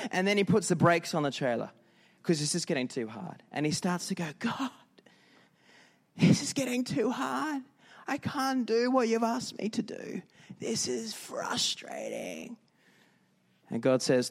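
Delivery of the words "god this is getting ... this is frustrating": high strained whine sinking into a slow, breathy last line; hammed-up plea of someone worn out from pushing; exhausted complaint. Exhausted man complains to God.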